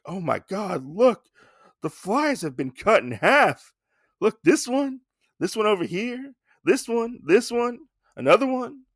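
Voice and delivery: high-pitched, rising disbelief; imitated stunned onlooker; mock astonishment. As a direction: point out each discovery, amazed. Retold shock at halved flies.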